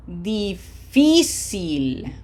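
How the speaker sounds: Difícil, slowly, with FÍ punched; stress on second-last syllable. Shown as a demonstration. Teacher modelling for students, slow Difícil stressed on FÍ. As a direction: stretch Difícil and hit the middle FÍ. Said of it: "on the red syllable, syllable number two".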